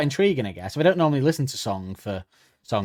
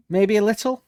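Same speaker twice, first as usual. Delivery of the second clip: high, louder teasing question; needling; 9 semitones higher, 6 dB louder than usual. Acted. Woman in the song prodding her angry man, needling. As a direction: lift it high like a goading little prod.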